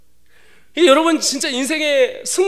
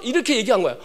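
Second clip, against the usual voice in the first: ordinary talk, nothing marked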